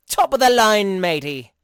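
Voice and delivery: tossing in a cheeky Irish lilt for a moment; sudden Irish accent. Acted. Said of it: "I don't know why I went Irish there".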